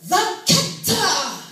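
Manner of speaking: high, loud, slow sung preaching; preacher father. Retold or imitated. Father teaching his son to read, preaching the words. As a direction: belt it out like a hurly-whirly preacher. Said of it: "I read like I was preaching".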